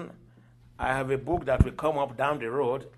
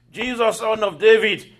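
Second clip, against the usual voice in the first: loud, raised cry for help; retold scream; 8 semitones higher, 10 dB louder than usual. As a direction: call out high and loud, imitating the blind man's scream. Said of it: "He was screaming".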